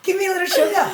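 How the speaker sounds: high, playful ask with a laugh; wife mock-flirting at her husband, high and laughing; flirty plea. Voicing the wished-for request.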